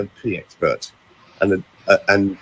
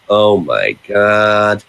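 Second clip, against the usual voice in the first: mock Los Angeles accent, drawn out slowly; long, languid Los Angeles vowels; 4 dB louder, much slower than usual. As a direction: imitate a blissed-out Los Angeles girlfriend. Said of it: "Los Angeles accent".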